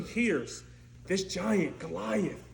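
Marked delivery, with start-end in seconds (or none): none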